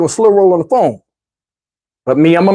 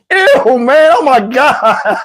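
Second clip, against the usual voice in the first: disgusted; 6 semitones higher, much slower than usual. Real, not acted. Reacting to greasy mask.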